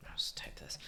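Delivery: whispered aside while typing. Real, not acted. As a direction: whisper the apology under your breath.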